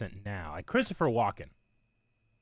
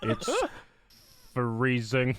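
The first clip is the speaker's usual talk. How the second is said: very slow, drawn-out impression; mock slow talker, pausing between words; Alan Rickman; much slower than usual. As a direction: stretch each word out, with long gaps. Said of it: "Or Alan Rickman. Everybody's really slow".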